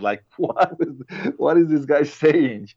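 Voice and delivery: puzzled, slowed wondering aloud; baffled. Retold or imitated. Guest recalling reading the book, baffled. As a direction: act lost in a confusing book. Confused reader quoting own thought.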